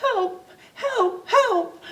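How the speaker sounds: high, whimpering cries for help. A narrator's reading. Figgy stuck in the tree whimpers for help. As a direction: whimper the words, near tears. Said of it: "heard somebody whimpering".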